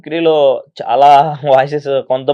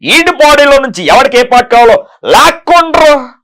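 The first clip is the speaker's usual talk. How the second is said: high, loud furious jealous lover; a big, loud movie-star rant in a raised voice; Prakash Raj impression; 9 semitones higher, 7 dB louder than usual. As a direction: rage at him like a wronged lover, rising to a roar. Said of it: "Prakash Raj గారు".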